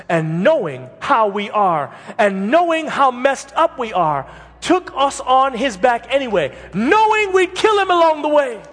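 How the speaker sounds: building to a shouted, high climax; rising passion, hammering each knowing; preaching at full force. Acted. Preacher to congregation about Jesus, building to full shouted fervour.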